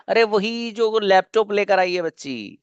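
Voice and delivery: usual speaking voice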